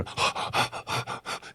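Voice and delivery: sharp startled gasp, breath only; a sudden breathy gasp of alarm. Retold or imitated. Hunter retelling being startled in the woods, breathy gasp.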